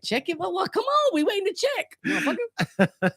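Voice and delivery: squeaky, pitched-way-up heckle; mock him for stalling, high; laughing exasperated heckler; high impatient mock. Impatient listener mocking.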